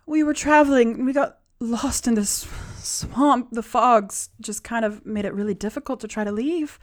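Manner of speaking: rattled survivor recounting her ordeal; distressed captive woman. Acted. Captive woman explains how she got lost.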